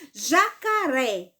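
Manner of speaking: slow "Jacaré", stressing the final "ré"; teacher sounding out "Jacaré" with a long strong "ré"; stress on last syllable. Shown as a demonstration. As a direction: draw out "Jacaré" and land on "ré". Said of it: "O pedacinho mais forte foi o ré".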